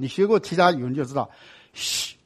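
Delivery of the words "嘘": 嘘 as a long hushed sh; hushing sh sound. Shown as a demonstration. Teacher contrasting two sounds, 嘘 as a hissed sh. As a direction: hold 嘘 as sh, distinct from S. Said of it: "嘘跟S是不一样的音".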